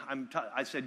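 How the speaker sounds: normal talking voice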